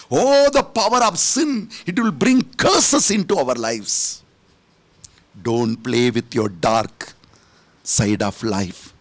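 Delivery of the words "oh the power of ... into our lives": ringing proclamation. Shown as a demonstration. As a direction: lift it up and proclaim it. Preacher laments sin twice.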